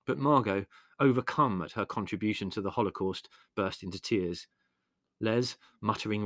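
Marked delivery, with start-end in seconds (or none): none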